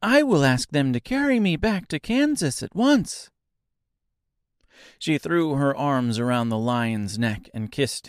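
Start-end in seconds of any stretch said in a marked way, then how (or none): 0.0-3.3 put-on high, bright delighted girl; joyful child. A narrator's reading. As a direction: burst out with joy. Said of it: "said the child joyfully".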